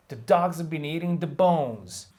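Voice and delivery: "dogs", "eating", "bones" hit, the rest rushed and blurred; function words mashed. Shown as a demonstration. Teacher shows reduced function words between stresses. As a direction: squeeze the small words between beats. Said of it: "The other words are kind of mashed together".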